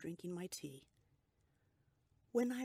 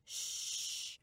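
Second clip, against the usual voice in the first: hushing. A narrator's reading. Husband hushes his sick wife to rest. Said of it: "he said".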